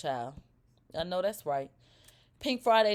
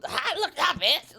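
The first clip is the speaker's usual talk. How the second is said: "How I look now" pushed higher, mildly pressed; mock pressed retort; 7 semitones higher, 3 dB louder than usual. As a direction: imitate her sounding pressed, pitched up on "look". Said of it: "But it was".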